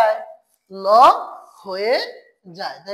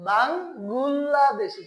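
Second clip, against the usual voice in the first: Bangladeshi drawn out very slowly, each syllable separate; slow demo; much slower than usual. Shown as a demonstration. Teacher slowly demonstrating the word to students. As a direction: stretch Bangladeshi out slowly so every sound is heard. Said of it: "তাহলে কি হয়ে গেল?".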